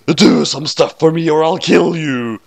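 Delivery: lightly voiced killer, slowed down; villain threatens Ray, mildly hammed-up; Light's threat.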